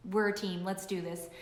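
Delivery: soft, low voice of a loyal husband; husband's quiet support. Retold or imitated. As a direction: quietly promise to back her up. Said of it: "And he said".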